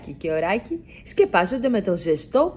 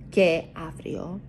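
fading whisper; 6 dB quieter than usual. A narrator's reading. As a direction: end the bedtime story softly. Bedtime story closing.

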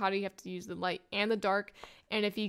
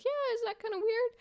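puzzled; 14 semitones higher than usual. Retold or imitated. Character agrees, puzzled.

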